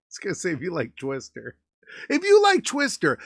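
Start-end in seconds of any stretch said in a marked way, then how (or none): 2.1-3.2 loud, high-pitched restart; hyped-up plug. Real, not acted. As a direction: burst into a punchy viewer plug. Restarts plug with sudden hype.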